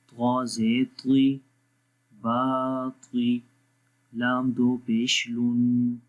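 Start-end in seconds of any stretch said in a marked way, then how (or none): 2.1-3.4 बाती with the बा drawn out long, slowly; long baa. Shown as a demonstration. Teacher drawing out the vowel for students. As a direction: stretch the बा in बाती. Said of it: "बा को लंबा करेंगे".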